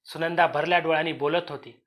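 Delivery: plain reading voice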